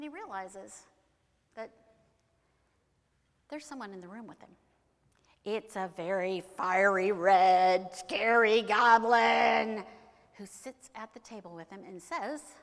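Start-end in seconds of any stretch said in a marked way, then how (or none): 5.4-9.8 slow, loud, stretched-out announcement; scary reveal. A narrator's reading. Storyteller reveals the fiery red goblin, slow and booming. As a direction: build it big and drag out each word.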